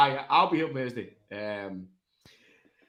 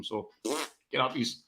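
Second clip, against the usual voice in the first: normal talking voice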